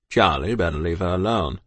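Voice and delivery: low-pitched comrade's caution; warning soldier. A narrator's reading. Soldier warning Charlie off the child, low. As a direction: drop low and warn him off.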